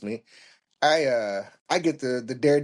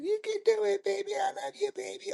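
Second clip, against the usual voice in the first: soft, very high falsetto; hammed-up girlfriend pep talk; encouraging girlfriend; 5 dB quieter, faster than usual. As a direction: squeak out mock loving support. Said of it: "one of the girlfriends jump out".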